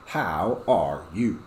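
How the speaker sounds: slow, drawn-out model question; slow drill question. Shown as a demonstration. Teacher modelling the question, slow. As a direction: model it slowly for the class. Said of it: "this one should be".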